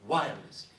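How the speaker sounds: "wirelessly" punched loud, then falling; loud, emphatic "wirelessly" as the closing release; stressed demonstration. Shown as a demonstration. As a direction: launch "wirelessly" forcefully and let it drop. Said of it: "l'accentuation ne se fait jamais".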